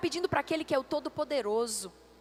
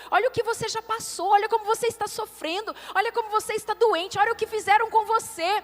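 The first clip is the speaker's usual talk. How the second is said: higher, insistent acted accuser; mounting taunt, growing louder; voice of doubt; 5 semitones higher, 4 dB louder than usual. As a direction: pile on reasons to doubt. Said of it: "a boca de Satanás aqui ministrando".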